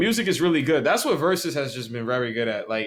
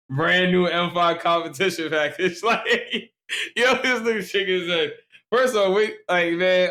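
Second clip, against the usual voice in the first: laughing; 6 semitones higher, 3 dB louder than usual. Real, not acted. Laughing at friend's new car.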